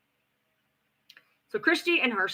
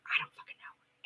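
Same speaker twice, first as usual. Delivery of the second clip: whispered under the breath, much softer; whispered aside; 14 dB quieter than usual. Real, not acted. Vlogger whispering an aside to viewers, much softer.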